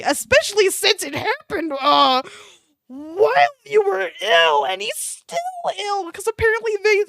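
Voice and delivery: high, breaking with laughter, near tears; losing it completely while reading; laughing fit. Real, not acted. Laughing at the chat, voice cracking high.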